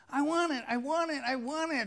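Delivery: high, whiny, repeated demand; mock whining; whiny kid nag. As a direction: nag like a pestering child. Imitating nagging people.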